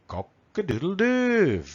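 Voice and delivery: high, slow, stretched-out crow; rooster crow. Acted. Teacher correcting kikiriki to the English call, crowing.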